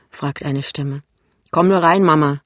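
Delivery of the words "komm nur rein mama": loud, proud invitation; proud son welcoming his mother in; full of pride. A narrator's reading. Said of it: "sagt Pinneberg voll Stolz".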